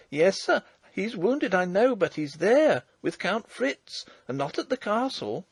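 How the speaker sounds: put-on high, eager young peasant girl; a breathless country girl bursting with news; excited farm girl. Girl insists to old marshal.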